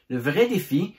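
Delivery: ordinary talk, nothing marked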